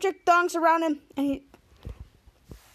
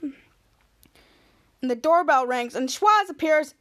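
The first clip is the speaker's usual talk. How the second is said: usual speaking voice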